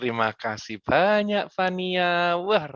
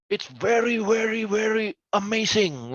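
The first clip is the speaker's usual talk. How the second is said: gushing "It's very, very, very amazing" drawn out, higher; mock effusive English; 5 semitones higher than usual. Coach mocking long English phrasing, gushing and higher. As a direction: ham up a wordy English speaker. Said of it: "kita ngomong, eh, bahasa Inggris".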